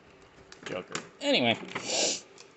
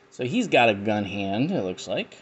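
ordinary delivery

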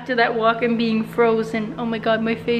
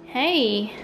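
ordinary delivery

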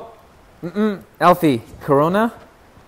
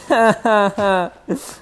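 high giggling laugh; 7 semitones higher than usual. Real, not acted. Player amused by his weapons, high giggle. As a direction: run of quick high ha-has.

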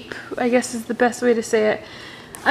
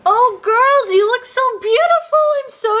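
much higher, gushing mother voice; sing-song proud mom; doting mom; 10 semitones higher than usual. Acted. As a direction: beam at the girls. Mom doll greeting daughters.

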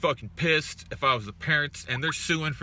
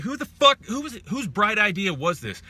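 higher, louder outraged shout; 8 semitones higher, 3 dB louder than usual. Real, not acted. Ranting to chat about needless fillings, outraged yell. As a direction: yell it in disbelief.

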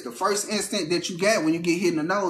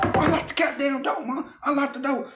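frantic plea; 8 semitones higher than usual. Retold or imitated. Pleading with mom from outside car.

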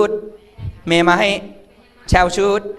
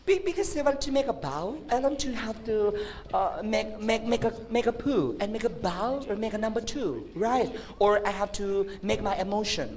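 soft, higher, halting explanation; timid student; 4 semitones higher, 9 dB quieter, much slower than usual. Acted. Student explaining toilet need to teacher, meek hesitant voice. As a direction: stammer it meekly and slowly to a teacher.

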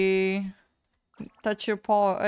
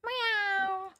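high cute meow; cat meow; 10 semitones higher, 3 dB quieter than usual. Pretend. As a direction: meow like a kitten. Meowing at cat in game.

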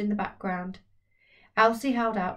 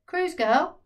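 loud, much higher call with rising end; 8 semitones higher, 6 dB louder than usual. Acted. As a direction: summon her from down the hall. Voice calling from another room.

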